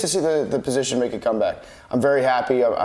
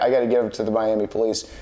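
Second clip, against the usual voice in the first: usual speaking voice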